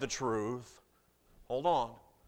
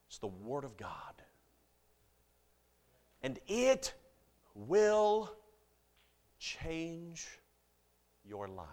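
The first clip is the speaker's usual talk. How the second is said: hushed sermon climax; solemn preaching; 4 semitones higher, slower than usual. Acted.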